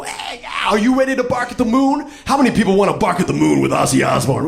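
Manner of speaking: high, loud, fast hype shout. Retold or imitated. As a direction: hype the crowd like a metal frontman. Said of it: "he was gonna come into the store and go".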